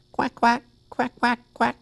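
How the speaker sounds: high, short duck quacks; duck quacking. Pretend. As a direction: quack like a little puppet duck. Quacky the Duck puppet answers.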